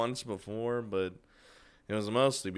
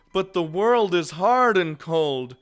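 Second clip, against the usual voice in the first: higher, louder mock-grand pronouncement; mock profound; 8 semitones higher, 8 dB louder than usual. Real, not acted. Mock philosophical riff.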